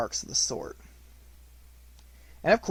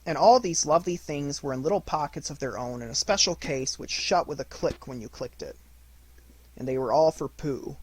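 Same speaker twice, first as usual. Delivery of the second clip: ordinary delivery, nothing marked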